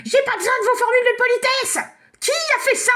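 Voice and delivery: fairy brushes off Elena's apology, angry; indignant fairy. Acted. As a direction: snap back at the polite child. Fairy rejects politeness.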